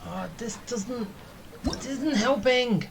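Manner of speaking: ordinary talk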